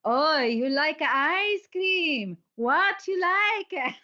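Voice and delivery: slow, high, sing-song talking-down voice; drawn-out, high and sugary, like to a toddler; patronising waiter. Retold or imitated. Waiter talking down to Ollie over ice cream, patronising. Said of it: "he was being really patronising".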